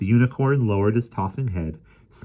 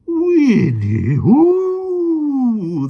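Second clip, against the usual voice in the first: high loud whinny; happy neigh; 10 dB louder, much slower than usual. A narrator's reading. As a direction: neigh with joy. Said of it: "The unicorn gave a happy neigh".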